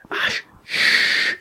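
voiceless hush with a long shh; retold drunken shushing; whispered shushing. Shushing partner after loud door.

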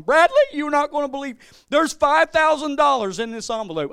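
high, loud, astonished mock cry; imitated overwhelmed mom. Mom telling Bradley about the money, high excited shout. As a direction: shout it like a bawling, amazed mother. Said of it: "So my mom".

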